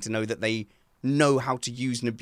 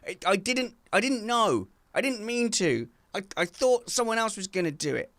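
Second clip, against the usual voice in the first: higher, whiny excuse voice; mock innocent excuse; 9 semitones higher than usual. Dad acting a feeble excuse to wife, higher. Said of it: "So we have to double down and go".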